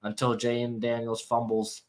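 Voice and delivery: usual speaking voice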